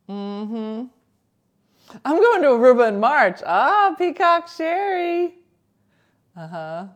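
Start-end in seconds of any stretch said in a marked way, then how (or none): 2.0-5.4 loud, bright anchor read; news anchor voice. Pretend. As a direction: sell it like a TV anchor.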